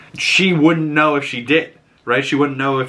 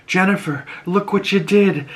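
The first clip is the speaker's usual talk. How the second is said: put-on higher, awed old witch; mock amazed Lilia; 8 semitones higher than usual. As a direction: marvel at what she did. Lilia marvels at Jen.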